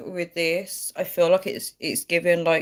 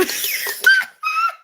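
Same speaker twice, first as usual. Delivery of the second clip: very high squealing laugh; real high-pitched giggling fit; helpless laughter; 4 dB louder than usual. Real, not acted. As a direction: burst out in uncontrollable high laughter. Cracks up at own dirty story.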